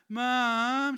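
loud, high nagging call of Mom; whiny child calling. Retold or imitated. Child calling mother in the morning, loud whiny call. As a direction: call across the house, whining. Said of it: "A bit whiny".

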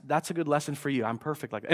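low, joking aside of self-praise; mock smugness. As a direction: drop low for tongue-in-cheek boasting. Jokey self-praise, then just kidding.

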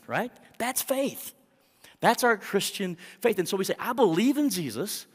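loud, high preaching, pushing hard on every line; full-force preaching. Acted. Pastor preaching full force to the congregation.

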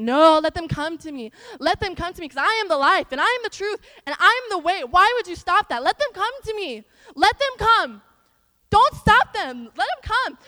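loud, high, impassioned preaching as Jesus; frustrated plea. Acted. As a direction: plead forcefully, driving each phrase home. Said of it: "he's saying he's so frustrated".

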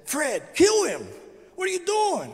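high, dragged-out mock crowd urging Fred on; egging-on onlookers. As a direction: play the crowd pushing him to strike. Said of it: "people in the house who said".

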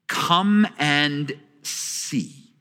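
drawn-out, very slow come and see; a slow, calling invitation. Retold or imitated. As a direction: stretch every word, beckoning. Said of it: "she says".

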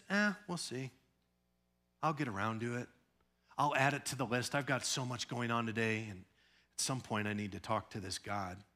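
soft, offhand shrug of a voice; mock lazy believer. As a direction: play a believer putting God off.

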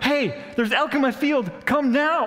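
urgent farmer. Retold or imitated. Farmer calls about elk.

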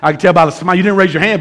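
ordinary talk

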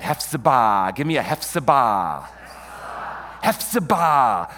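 Hephzibah loud, with the accent on the final BAH; end-stressed pronunciation. Shown as a demonstration. Preacher getting the congregation to repeat, Hephzibah stressed at the end. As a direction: punch out Hephzibah as hef-zi-BAH. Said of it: "Hebrew loves the back end for the accent".

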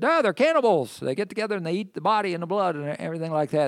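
a mocking heckler, high, loud and fast; mocking accuser. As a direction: sneer it like early critics of the Christians. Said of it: "they made fun of the Christians".

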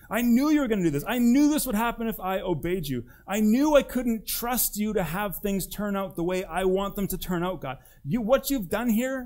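imitating an angry prophet yelling at God; mock angry yelling. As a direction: rant like a sulking prophet. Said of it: "he basically just yells at God".

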